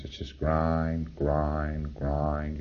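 slow, low, sinking drone on each grind; slow grinding monotone. Shown as a demonstration. Lecturer showing a PC's grinding drone, slow and low. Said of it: "in that tone of voice".

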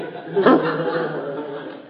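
amused laughter after the punchline; hearty laugh. Real, not acted. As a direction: laugh at your own joke. Laughs at his own story.

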